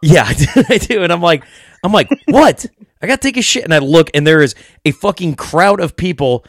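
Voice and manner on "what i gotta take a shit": high, loud startled what; jolted alarm, voice jumping up; yelped high and loud, caught off guard. Retold or imitated. Startled at being called over.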